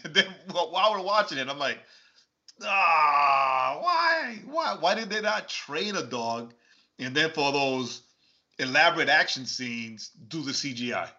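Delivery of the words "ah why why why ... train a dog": mock anguish. Viewer protesting the fake dog, mock-anguished wail. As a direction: cry out in hammed-up despair.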